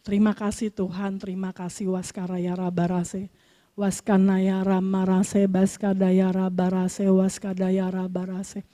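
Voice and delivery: murmured slow thanksgiving, low and hushed; slow soft prayer. Real, not acted. Pastor quietly thanking God after the sermon, slow hushed prayer. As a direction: pray softly and slowly in tongues.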